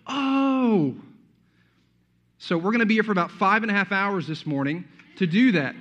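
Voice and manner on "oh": high, drawn-out mock "aha" oh; sudden realization. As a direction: act out the penny finally dropping. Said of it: "Then we finally go".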